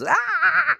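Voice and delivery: very high, loud shriek; demon shriek. Acted. Possessed man as the demon leaves him, high shriek. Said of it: "shrieks out this demon".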